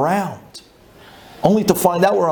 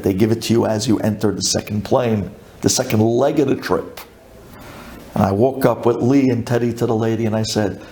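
ordinary talk, nothing marked

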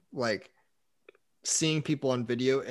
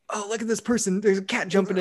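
high, louder, excited pointing-out; mock-excited onlooker; delighted noticing; 7 semitones higher, 4 dB louder, faster than usual. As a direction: perk up at a sudden distraction. Bored attendee suddenly spots a cat.

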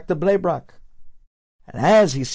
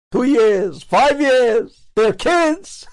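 rising higher and louder with each item; building amazement; 8 semitones higher, 5 dB louder, much slower than usual. Retold or imitated. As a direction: climb in pitch as the list grows. Rabbi's amazed list grows.

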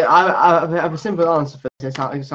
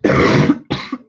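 rough hacking cough; harsh cough; 4 dB louder than usual. Real, not acted. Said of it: "that was not a nice noise".

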